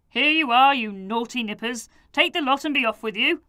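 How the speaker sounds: put-on loud, fast jolly old man; smiling send-off. Mister Sniffer cheerfully sending the children away. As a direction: shoo them off with a grin. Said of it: "he said, smiling".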